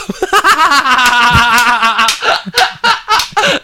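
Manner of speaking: loud, high, sustained cackle; long burst of laughter. Real, not acted. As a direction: laugh helplessly at your own innuendo. Cracks up at own innuendo.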